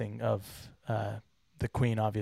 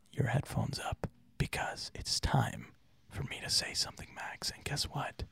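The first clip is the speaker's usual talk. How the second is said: breathy close whisper; ASMR whisper; 5 dB quieter than usual. Shown as a demonstration. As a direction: whisper right into the mic. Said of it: "I have something to say about A S M R".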